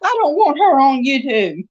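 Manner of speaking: high hammed-up husband voice; mock husband. Wife imitates her husband guarding his bird, high mock voice. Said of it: "he'd be like".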